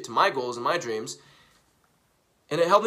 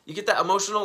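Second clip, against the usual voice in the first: ordinary delivery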